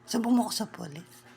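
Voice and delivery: ordinary talk, nothing marked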